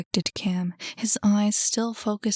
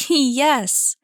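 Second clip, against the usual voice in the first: loud, raised cry of victory; triumphant cry; 5 semitones higher, 5 dB louder than usual. A narrator's reading. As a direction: crow over the win. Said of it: "she cried".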